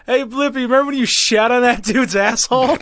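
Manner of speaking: high, loud taunting heckle; heckling. Pretend. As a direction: yell up at the stage to taunt him. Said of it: "heckle the fuck out of him and be like".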